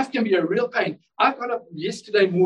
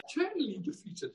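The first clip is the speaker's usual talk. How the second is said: soft echo; 7 semitones higher, 12 dB quieter than usual. Acted. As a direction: drop to a much softer, hushed repeat. Quiet echo after the shout.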